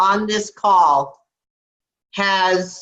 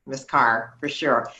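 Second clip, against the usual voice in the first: ordinary delivery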